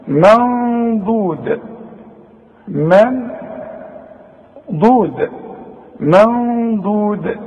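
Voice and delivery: منضود slowly with ن hidden and nasal; slow demo. Shown as a demonstration. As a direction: draw out منضود. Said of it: "بعدها ضاد".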